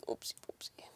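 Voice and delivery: hushed, voiceless whisper; whispered oops. Real, not acted. Streamer fumbling with the audio, whispered to self. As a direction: whisper it under your breath. Mutters, then repeats it aloud.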